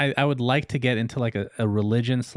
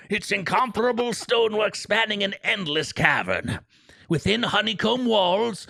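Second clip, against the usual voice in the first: put-on Old Man Gillywinkle; 6 semitones higher than usual. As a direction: creak it out like a grand old lore-keeper, much higher than your own. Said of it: "Old Man Gillywinkle?".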